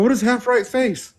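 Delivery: high puzzled question; asking, totally lost; bewildered recruit; baffled. Retold or imitated.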